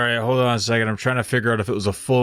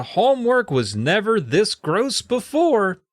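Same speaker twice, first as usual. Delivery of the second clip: dramatic read; 8 semitones higher, slower than usual. Shown as a demonstration. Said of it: "it says".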